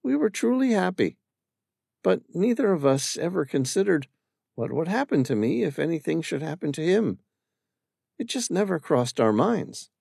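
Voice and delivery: put-on a young woman remembering her husband; Amira's voice. Amira telling the stranger about her late husband, wistful.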